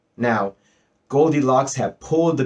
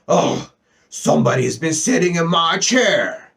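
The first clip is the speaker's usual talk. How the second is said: big bear's rough complaint, higher and forceful; great rough gruff bear; 5 semitones higher, 4 dB louder than usual. A narrator's reading. Great big bear finds his chair disturbed, rough gruff voice. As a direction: growl it out like a huge bear. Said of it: "said the great big bear in his great rough, gruff voice".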